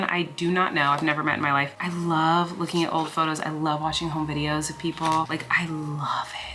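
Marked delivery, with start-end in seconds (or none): 5.3-6.5 whispered. Real, not acted. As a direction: whisper it like a secret delight. Gushing about old photos.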